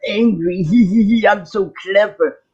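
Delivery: mock someone pleased with their own cleverness; smug mockery. Imitating smug trolls on their high horse, mock gloating. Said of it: "sit on their high horse and say".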